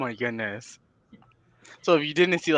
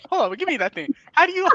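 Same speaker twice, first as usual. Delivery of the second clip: mock bossy; 5 dB louder, faster than usual. Mimicking bossy client.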